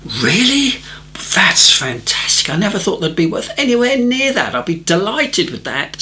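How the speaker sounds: high, amazed and thrilled; delighted surprise. Retold or imitated. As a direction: gasp with disbelieving joy. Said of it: "he says".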